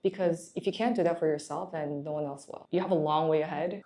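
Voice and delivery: ordinary talk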